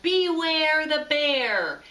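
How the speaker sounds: slow, loud, growled warning; a big bear calling out from the dark; growly bear voice. A narrator's reading. Tacky playing the bear, booming slow and loud. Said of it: "a growly, great big voice called out".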